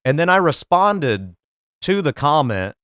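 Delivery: normal talking voice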